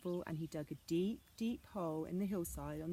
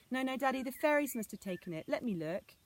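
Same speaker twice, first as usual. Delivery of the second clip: higher, louder urgent protest; pleading cry; 6 semitones higher, 5 dB louder than usual. Acted. Anna begging father to let her look, urgent. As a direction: insist urgently. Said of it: "cried Anna".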